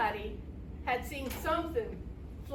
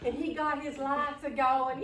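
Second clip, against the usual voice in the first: plain reading voice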